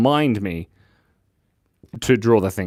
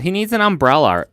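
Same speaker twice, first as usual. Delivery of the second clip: ordinary talk, nothing marked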